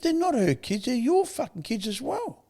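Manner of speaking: very high, exasperated telling-off; retold straight talk. Advisor correcting his mate about the kids, exasperated. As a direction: drive it home slowly at a high pitch. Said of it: "gives it to you fucking straight".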